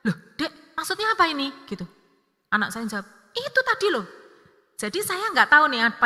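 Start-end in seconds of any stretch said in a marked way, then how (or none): 0.1-1.5 high-pitched startled question; startled mother. Retold or imitated. Mother asking her child after being pushed, startled. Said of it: "saya kaget lah". 3.4-4.1 very high-pitched defensive comeback; snappy retort. Retold or imitated. Child answering mother's question, snappy. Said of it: "langsung kalimatnya tuh ngegas".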